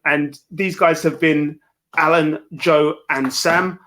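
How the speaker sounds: ordinary delivery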